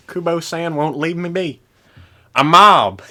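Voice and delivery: heavy southern drawl, imitated; mock the thick southern accent. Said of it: "heavy southern accent there".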